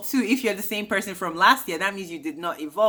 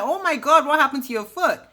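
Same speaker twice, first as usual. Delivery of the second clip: high, louder exclamation of shock; mock surprise; 8 semitones higher, 3 dB louder than usual. Vlogger acting Ray spotting Giselle's foot, mock surprise. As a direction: imitate someone startled by an injury.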